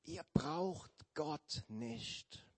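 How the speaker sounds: slow, drawn-out snake voice; slow sly serpent. Acted. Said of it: "flüsterte die Schlange".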